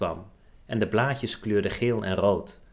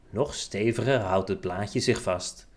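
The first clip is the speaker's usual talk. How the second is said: usual speaking voice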